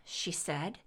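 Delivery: ordinary delivery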